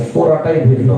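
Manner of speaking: ordinary delivery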